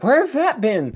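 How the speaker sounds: high-pitched hollering coach impression; Mister Parker. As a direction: holler it like an excited bowling coach. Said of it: "let me do my best Mister Parker impression".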